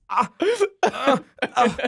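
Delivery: short strained grunts; imitating grunts. Describing the voice actor, mock grunt session. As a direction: grunt like a flustered man. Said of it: "the grunt session for him".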